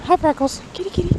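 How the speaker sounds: high, sweet pet-calling voice; calling a cat. Real, not acted. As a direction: coax the kitty over.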